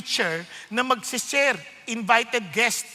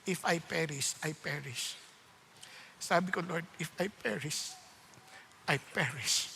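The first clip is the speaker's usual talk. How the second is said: low, soft, hushed vow; solemn surrender; 9 semitones lower, 11 dB quieter than usual. Retold or imitated. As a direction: give up to God with quiet resolve. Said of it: "sabi ni Esther".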